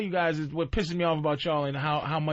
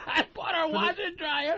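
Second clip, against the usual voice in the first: much higher, laughing through the words; amused laugh; 10 semitones higher than usual. Real, not acted. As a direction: giggle out the confession. Laughing admission.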